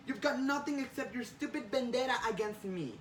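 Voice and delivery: high-pitched, heated tirade; angry accusation. Acted. Spider-Man attacking Jonah's vendetta, heated. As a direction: lay into them without letting up.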